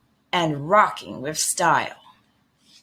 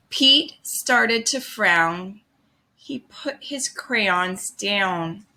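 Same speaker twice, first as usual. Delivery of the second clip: ordinary delivery, nothing marked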